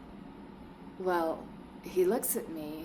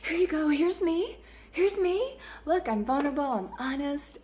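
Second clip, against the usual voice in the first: much higher, eager mock gush; gushing oversharer; 10 semitones higher, 4 dB louder than usual. Imitating her old self, pitched way up and eager. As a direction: hand over everything in a bright squeal. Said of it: "I come from a space of".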